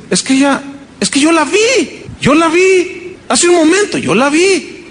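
high, insisting she saw her; insistent. Retold or imitated. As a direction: press the point over and over. Neighbour insisting.

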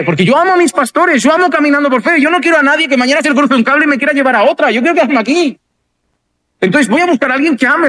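fast, fervent believer; passionate devotion. Pretend. Voicing a devoted church member.

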